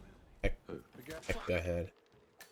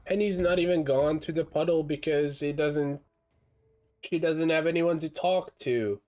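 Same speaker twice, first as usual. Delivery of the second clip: excited; 9 semitones higher than usual. Real, not acted. Reacting to a failed takedown.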